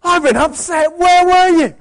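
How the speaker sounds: put-on high, loud wounded child accusing parent; mock-upset kid scolding the parent for leaving. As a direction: whine like an upset child demanding answers. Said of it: "as the kids get older".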